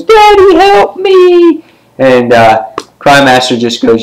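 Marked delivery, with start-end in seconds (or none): 0.0-1.6 very high, drawn-out falsetto plea; mock cry for help. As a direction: wail like a falling child calling for a parent. Acts falling villain crying for father.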